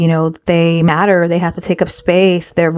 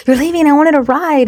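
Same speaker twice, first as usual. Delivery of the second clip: high-pitched mock child complaint; daughter's protest; 8 semitones higher than usual. Daughter to mom who is leaving, high retold protest. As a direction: imitate a kid caught off guard, pitched well up.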